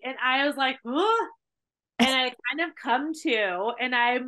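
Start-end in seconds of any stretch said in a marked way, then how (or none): 0.9-1.3 high confused grunt on waking; jolted awake, lost; groggy high huh; dazed huh. Retold or imitated. Waking up out of a blackout.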